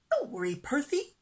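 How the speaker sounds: high, quick, lilting encouragement; reassuring little fish. A narrator's reading. Little fish comforting his friend, high and upbeat. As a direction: cheer up a friend in a small high voice. Stanley cheers up frightened friend.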